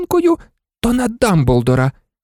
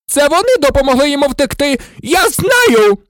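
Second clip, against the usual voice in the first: fast, high-pitched accusation building louder; enraged howl; 13 semitones higher, 4 dB louder than usual. A narrator's reading. Snape pointing at Harry and Hermione, howling. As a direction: scream it in wild fury. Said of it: "волав Снейп".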